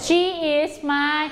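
very slow, word-by-word drill answer; slow choral answer. Shown as a demonstration. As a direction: lead the class slowly. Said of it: "ตอบพร้อมๆกันเลยค่ะ".

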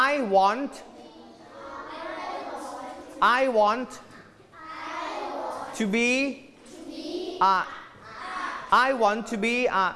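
"I want to be a" modelled clearly for repeating; careful teacher's model of "I want to be a"; clear enunciation. Shown as a demonstration. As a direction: say "I want to be a" distinctly, in separate chunks. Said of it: "ออกเสียงชัดๆ พร้อมทีเชอ".

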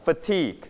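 Fatigue with the weight on its second syllable; stress on second syllable. Shown as a demonstration. Teacher modelling a new word for students, stress on second syllable. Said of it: "we stress on the second syllable".

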